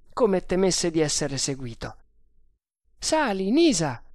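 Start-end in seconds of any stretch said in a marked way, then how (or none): 3.0-4.0 high raised call. A narrator's reading. Sergeant urging Nisa upstairs, calling out. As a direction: call up high, louder on the name. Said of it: "gridò Bharata".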